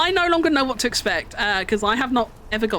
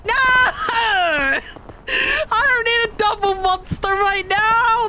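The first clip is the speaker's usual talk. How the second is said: despairing wail; 11 semitones higher than usual. Real, not acted. Wrong item, comic despair.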